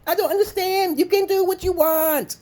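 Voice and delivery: very high, loud words through laughter; squealing laughter. Retold or imitated. Jeering at the troll who got trolled back, squealing with laughter. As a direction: crack up while jeering, stretching the words high.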